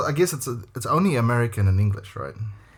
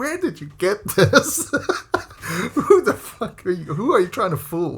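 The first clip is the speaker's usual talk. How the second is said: incredulous; 10 semitones higher than usual. Retold or imitated. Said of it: "It's like".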